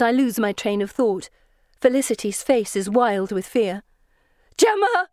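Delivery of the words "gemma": high, desperate call; frightened cry. A narrator's reading. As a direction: cry out the name in fear. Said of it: "she cries".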